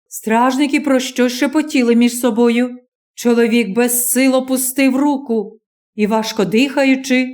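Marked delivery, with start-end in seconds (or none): none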